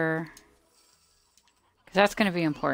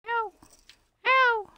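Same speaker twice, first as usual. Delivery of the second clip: squeaky slime cry; 7 dB louder than usual. Acted. Slime getting hit in game.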